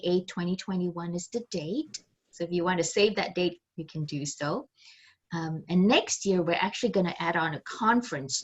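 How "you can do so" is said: usual speaking voice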